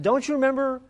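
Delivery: put-on high, slow, earnest child; a girl explaining love to her mother in a high voice; little girl voice. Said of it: "She said".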